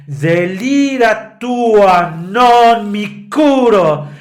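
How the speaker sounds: slow, loud scornful reply; contempt. Retold or imitated. Turiddu answering Santuzza with contempt. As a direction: sneer it out slowly.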